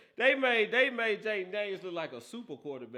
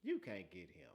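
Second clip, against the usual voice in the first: much softer, low-key aside; quiet retold remark; 9 semitones lower, 14 dB quieter than usual. Host recalling what he told Petty, much softer. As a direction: say it under your breath, almost to himself. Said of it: "I said".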